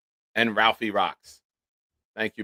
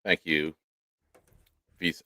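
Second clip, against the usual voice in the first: normal talking voice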